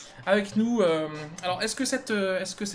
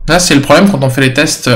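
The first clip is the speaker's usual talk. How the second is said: son saturé, micro qui crache; voix distordue par la saturation; 3 semitones lower, 16 dB louder than usual. Real, not acted. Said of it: "Le son sature". (micro saturé, son distordu)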